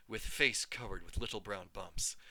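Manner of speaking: ordinary delivery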